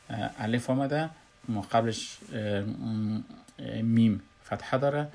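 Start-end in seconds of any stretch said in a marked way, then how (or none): none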